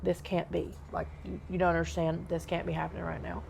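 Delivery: softly begging it not to be real; quiet disbelief. Retold or imitated. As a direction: plead under your breath. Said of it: "I just kept saying".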